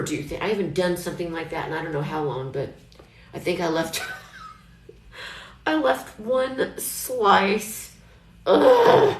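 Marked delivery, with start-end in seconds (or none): 5.7-9.2 laughing in embarrassment, with a sudden high groan. Real, not acted. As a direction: laugh it off, then a high, loud ugh. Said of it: "I mean, I'm laughing".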